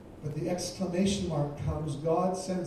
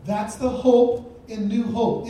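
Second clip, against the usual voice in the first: "That's the hope in New Hope" slow, higher and louder, hope drawn out; emphatic proclamation; 6 semitones higher, 9 dB louder, slower than usual. Shown as a demonstration. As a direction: declare it the second time with full force. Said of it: "repeated with force".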